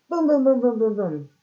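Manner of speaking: loud high boom boom boom, fading; drum booms. Shown as a demonstration. Teacher imitating drum booms for the story. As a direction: pound out the drum beats. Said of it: "banged on her drum set".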